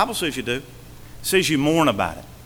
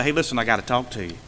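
soft, fast, private word to a brother; gentle confrontation; 7 semitones lower, 8 dB quieter, faster than usual. Retold or imitated. Pastor privately confronting a sinning church member, soft and quick. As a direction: quietly tell him it can't go on. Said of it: "to go to somebody and say".

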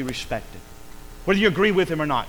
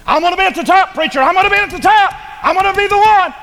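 high, loud bragging voice; much higher loud brag; boastful upstart; 11 dB louder, faster than usual. Acted. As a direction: brag like someone grabbing the top. Proud person voiced in sermon.

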